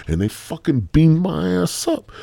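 ordinary delivery

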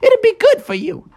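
high mom encouraging. Acted. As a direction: urge him out kindly but firmly. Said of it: "Froggy's mom was like".

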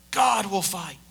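ordinary talk

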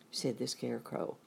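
ordinary delivery

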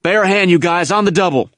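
high, called-out command; urgent order. A narrator's reading. NCO to his men under shelling, urgent order. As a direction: hurry the men along.

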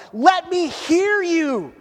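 high, drawn-out call; crying out. Acted. Woman calling to her beloved, crying out slowly. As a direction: stretch it out as an impassioned plea. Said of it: "I'm crying out to everybody".

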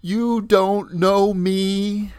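mock outrage. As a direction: act out Linda's wounded fury at the pharmacist. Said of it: "She says".

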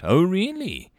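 high, mildly incredulous question; amused disbelief. A narrator's reading. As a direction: toss it back, amused and unconvinced. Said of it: "she asked amusedly".

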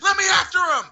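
high, urgent struggling shout; high-pitched retold outburst; imitated angry staffer. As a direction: act out someone fighting to get loose. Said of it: "He was like".

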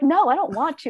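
high-pitched retold refusal; protesting. Retold refusal of demeaning roles.

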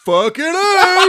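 high, slow laughing fucking A; laughing mock cheer. Host voicing the kid cheering the driver on, laughing. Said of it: "And he's like".

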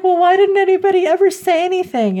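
very high, rushed mock indignation; hammed-up flustered duke; baffled protest. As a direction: squeal the question in disbelief. Acts the Duke's baffled protest.

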